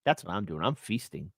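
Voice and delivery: soft, low gleeful gloating; quiet gloat. Acted. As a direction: savor it under your breath.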